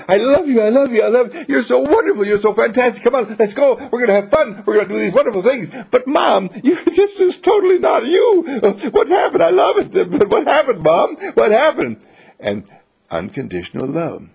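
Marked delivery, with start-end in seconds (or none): 0.1-5.7 overjoyed mother smothering her son with love; gushing love. Retold or imitated. 5.9-12.0 high, laughing, loud disbelief; amazed son. Retold or imitated.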